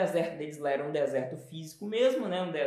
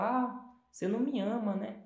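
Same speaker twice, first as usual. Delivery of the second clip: pouty self-pity; 3 semitones higher than usual. Retold or imitated. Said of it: "aquela coisa assim".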